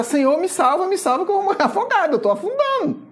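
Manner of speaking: high, loud, quickening plea of a drowning man; panicked cry for help. Retold or imitated. Preacher voicing sinking Pedro calling to Jesus, high and panicked. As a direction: cry out in mock panic as you sink. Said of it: "E começa a gritar".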